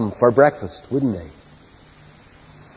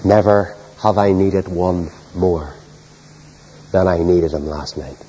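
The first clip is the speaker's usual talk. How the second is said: sincere hush; 6 semitones lower, slower than usual. Real, not acted. Preacher confessing his own need to congregation, low and sincere. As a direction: confide it gently. Quiet confession after story.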